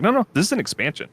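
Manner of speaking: firm, emphatic no, no; adamant. Retold or imitated. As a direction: insist that it is an expansion. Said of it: "C D Projekt was adamant".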